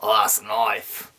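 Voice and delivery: understated hero showing off his blade; smug Dundee. Retold or imitated. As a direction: drop the voice a touch and sound pleased with yourself. Dundee pulls out his knife.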